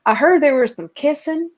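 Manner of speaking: a hint of a Southern pilot; Southern accent. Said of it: "he had this really thick Southern accent".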